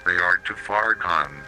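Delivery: usual speaking voice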